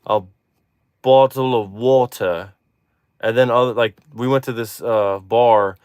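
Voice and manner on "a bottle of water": British "bottle of water" with crisp T's; careful British, "water" ending in "ah"; British accent. Shown as a demonstration. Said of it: "some people say".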